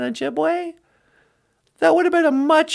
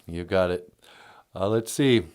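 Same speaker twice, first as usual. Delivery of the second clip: very deep voice; 7 dB quieter than usual. Pretend. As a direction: drop the voice very low. Voicing the low operator.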